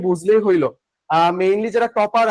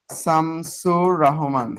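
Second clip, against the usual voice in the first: name drawn out very slowly, "Shamsur Rahman"; slow dictation; 4 semitones lower, much slower than usual. Shown as a demonstration. Teacher spelling out the poet's name for students, slow dictation. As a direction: stretch each syllable for note-takers.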